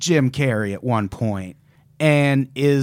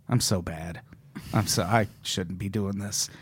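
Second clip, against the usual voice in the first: low, hushed retelling of an admission; subdued confession; 6 semitones lower, 10 dB quieter than usual. Retold or imitated. Retelling the actor breaking character to apologise, subdued and low. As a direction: drop right down, quietly owning up.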